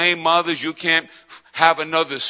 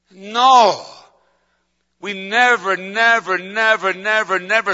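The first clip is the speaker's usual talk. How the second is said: emphatic refusal; 2 semitones higher, slower than usual. Real, not acted. Preacher refusing to shrink back, emphatic. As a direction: cry out a firm no.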